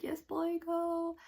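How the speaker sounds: put-on high cheering "yes boy, go"; excited fan cheer; cheering him on. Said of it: "I'm just like".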